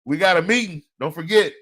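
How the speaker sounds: loud, high call across the room; calling out reminder. Retold or imitated. Coworker calling out a meeting reminder, loud and high.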